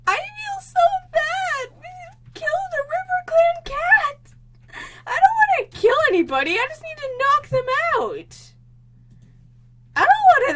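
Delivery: high, loud guilty wail; real anguish, pleading; distressed wail. Real, not acted. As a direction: cry out in remorse. Guilt over killing cat.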